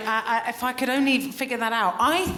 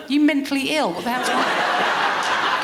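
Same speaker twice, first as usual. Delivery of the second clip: louder, laughing mock retort; mock outburst; 5 semitones higher, 4 dB louder than usual. Reacting to a mum-is-best-friend boast, louder mock scoffing. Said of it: "I think".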